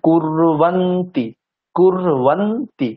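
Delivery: very slow কুরবন্তি, pressing the র and the ন; stress on কুর and ন. Shown as a demonstration. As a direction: split কুরবন্তি slowly, leaning on কুর then ন. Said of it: "উচ্চারণ কেমন করতে হবে?". (কুরবন্তি খুব ধীরে, র আর ন-তে জোর)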